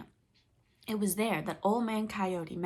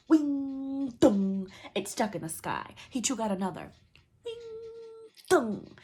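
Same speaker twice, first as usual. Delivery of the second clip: arrow sound effects; 2 semitones higher, 4 dB louder than usual. A narrator's reading. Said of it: "arrows flying, sound words".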